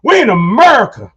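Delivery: hammed-up country accent for a crowd; country boy accent. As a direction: holler it in a thick country accent. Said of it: "I kept that country boy accent going on".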